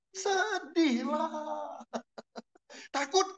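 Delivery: laughing. Real, not acted. Congregation answers, preacher laughs.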